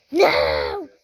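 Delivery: laughing shout. Real, not acted. Falls again, laughing.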